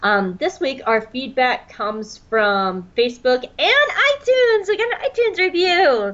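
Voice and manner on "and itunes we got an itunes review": high, thrilled announcement; excited high-pitched squeal of news. Real, not acted. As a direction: burst out with the happy news. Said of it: "that was very excited".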